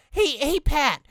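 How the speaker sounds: squeaky sponge coaxing his friend; SpongeBob voice. Acted. Sponge sweet-talking his friend in bed, high cartoon voice.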